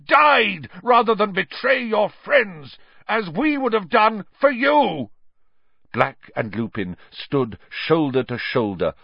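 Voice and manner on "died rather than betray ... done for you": loud, high, impassioned roar; furious full-voiced denunciation. A narrator's reading. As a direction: roar on in outrage.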